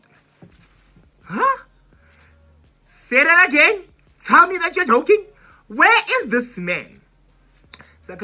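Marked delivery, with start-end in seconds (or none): 1.2-7.0 shocked disbelief. Retold or imitated. Shocked dad is not outside.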